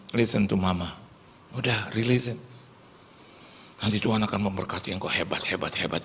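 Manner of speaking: put-on calm the child gently; soothing mother. Mother holds son's ear, soft reassuring voice.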